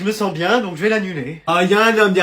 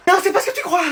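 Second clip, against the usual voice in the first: panicked denial; 10 semitones higher, faster than usual. Acted. Caught son panics.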